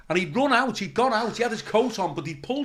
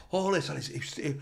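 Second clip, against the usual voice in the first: ordinary talk, nothing marked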